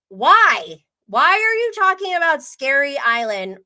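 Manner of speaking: loud, exasperated "why"; exasperated disbelief. Retold or imitated. Viewer reacting to the cast bringing up another show, exasperated.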